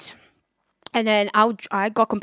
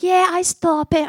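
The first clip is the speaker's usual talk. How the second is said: higher, louder put-on voice for the friend; friend's breezy reply; 7 semitones higher, 5 dB louder than usual. Friend shrugging off the cigarette offer, breezy. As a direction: toss it off lightly as the friend.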